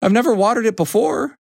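high-pitched hen in shock. A narrator's reading. Hen to Mister Horse, high shocked reply. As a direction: protest up high, taken aback.

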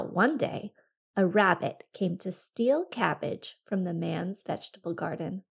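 ordinary delivery

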